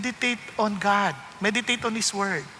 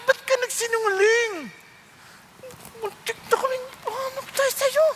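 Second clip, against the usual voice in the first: much higher, rising into a crying wail; Pharaoh's complaint; 13 semitones higher than usual. Acted. Pharaoh scolding Abraham for lying, mock tearful wail. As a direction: wail like a wronged king near tears. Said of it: "para sa amin".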